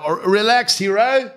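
high-pitched, drawn-up retort; mock placating. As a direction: tell him to calm down in a put-on high voice. Retold retort to pub man.

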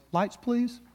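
a hint of a small boy asking politely; little voice. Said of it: "you get that little voice".

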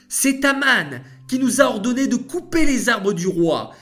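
nervous servant. A narrator's reading. Angel explains to king.

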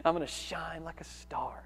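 hushed, whispered mock daydream of fame; softly mocking someone who dreams of stardom; mock dreamy boast. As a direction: breathe it like a secret wish to be famous. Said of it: "when they hear the word stars".

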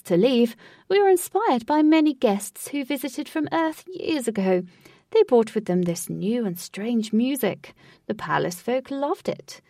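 lightly voiced theatrical host; proud showman tour guide; grand host voice. Lutz voiced as ride host.

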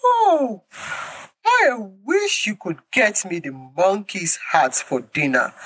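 high, wheedling alligator wife; pleading wife alligator. Acted. Wife alligator begging husband for monkey's heart, high wheedling voice. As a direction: coax your husband in a high sweet voice.

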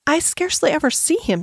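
high, halting stammer; embarrassed stammer. A narrator's reading. Embarrassed schoolgirl answers about her father, stammering. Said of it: "she stammered".

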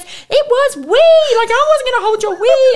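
very high, loud mock shriek; disgusted child. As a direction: imitate a girl squealing in outrage. Daughter's disgusted retort, acted out.

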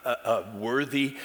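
usual speaking voice

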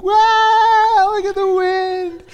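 excited wow. Retold or imitated. Excited Floridians watching hurricane.

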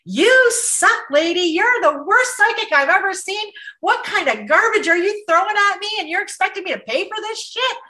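hammed-up furious outburst; angry rant. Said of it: "she just started spouting off".

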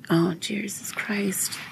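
breathless whisper, under the breath; hushed oh-no whisper at the monitor; whispered dread. Retold or imitated. Mom whispering at the oxygen monitor, dread.

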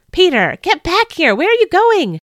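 high, loud shout after someone leaving. Acted. James shouts after Peter to come back, loud. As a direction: yell at him to come back. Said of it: "James shouted after me".

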